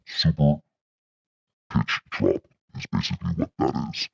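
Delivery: very low monster voice effect; deep pitch-dropped monster voice. Shown as a demonstration. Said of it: "Monster is very deep".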